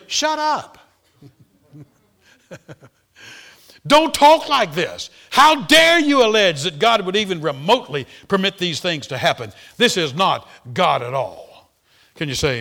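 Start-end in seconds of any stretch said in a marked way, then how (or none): 0.1-0.8 a high, sharp mock snap; mock shut up. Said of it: "his answer was simply". 3.9-11.7 indignant rebuke. Retold or imitated. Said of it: "his answer was simply".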